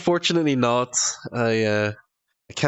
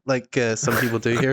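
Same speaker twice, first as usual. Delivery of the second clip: ordinary talk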